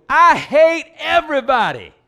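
high, loud, slowed-down yell; high hammed-up bellow, stretched out; mock shout. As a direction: pretend to blow up in church at full volume. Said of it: "walk in church and go".

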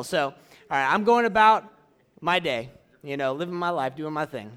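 normal talking voice

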